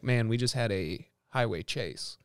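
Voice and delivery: low, soft, slow imitation of a cop; lightly voiced laid-back cop, quiet and unhurried; understated cop. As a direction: casually confide it as the cop. Retelling the cop's casual boast.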